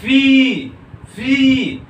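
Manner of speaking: "फी" high, with a long held ई; drawn-out syllable. Shown as a demonstration. Teacher isolating the Y sound, "फी" held long and high. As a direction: stretch the ई in "फी" high and strong. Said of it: "क्या आ रहा है pronunciation?".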